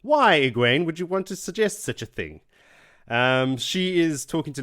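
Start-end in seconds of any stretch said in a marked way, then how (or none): 0.0-2.4 higher, louder mock outrage; scandalised. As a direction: clutch the pearls, scandalised. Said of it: "mock scandalised Aes Sedai".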